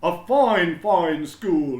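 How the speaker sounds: high, loud and slow, booming with pride; a beaming principal proclaiming to everyone; proud headmaster announcing. A narrator's reading. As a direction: announce it grandly to the whole school. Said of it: "Mister Keene said".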